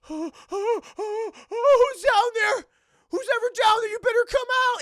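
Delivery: high, loud frightened challenge; high loud fearful shout; scared threatening call. Retold or imitated. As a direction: call into the dark, brave but scared. Mom in dark cellar.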